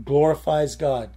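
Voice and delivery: usual speaking voice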